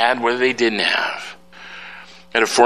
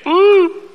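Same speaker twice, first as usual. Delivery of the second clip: woo; 6 dB louder than usual. Real, not acted. Mock self-congratulation.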